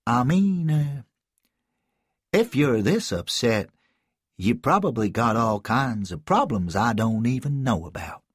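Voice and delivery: a hint of a hesitant adult reaching out; Malcolm voice. Malcolm comforts the crying boy.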